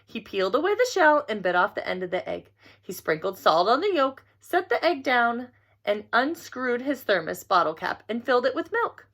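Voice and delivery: plain reading voice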